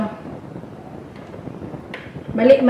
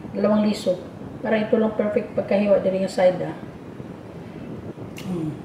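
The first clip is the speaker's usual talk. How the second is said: usual speaking voice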